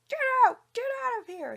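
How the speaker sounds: very high, loud scream. A narrator's reading. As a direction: scream him off the land. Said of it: "he screamed".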